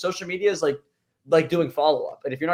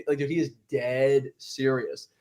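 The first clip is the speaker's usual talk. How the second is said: normal talking voice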